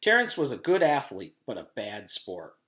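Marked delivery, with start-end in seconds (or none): none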